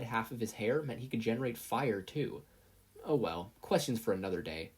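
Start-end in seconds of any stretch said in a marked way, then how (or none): none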